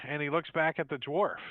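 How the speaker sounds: ordinary delivery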